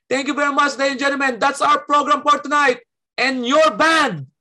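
imitating a host who closes the program too soon; host announcer voice. The host ends the program, announcer-style.

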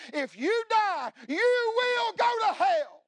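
father shouting hell's warning at his son; shouted warning. Retold or imitated.

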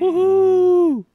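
high, loud woohoo; excited cheer. Real, not acted. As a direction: cheer loudly. Birthday cheer.

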